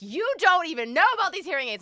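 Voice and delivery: mock huffy comeback; defensive mom. Mom snapping at her daughter, huffy. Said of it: "And she's like".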